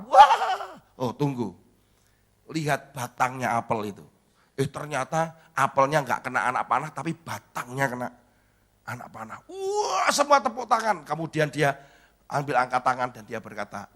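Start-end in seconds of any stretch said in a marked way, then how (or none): 0.0-0.7 high, loud imitated guffaw; crowd's mocking laughter. Onlookers laugh at the missed shot, loud mock laughter. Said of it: "semua tertawa". 9.5-11.1 cheering crowd. Retold or imitated. Imitates crowd cheering the shot.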